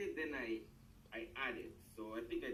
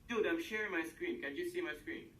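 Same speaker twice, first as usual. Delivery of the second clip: exasperated; 7 semitones higher, 6 dB louder than usual. Real, not acted. Frustrated over unseen screen.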